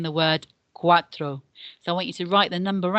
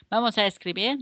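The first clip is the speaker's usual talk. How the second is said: ordinary talk, nothing marked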